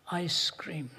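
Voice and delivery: whispered sweetly, the same answer again; soft whispered waitress reply. Retold or imitated. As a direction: breathe the two words as a secret. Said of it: "she spoke in a sweet voice".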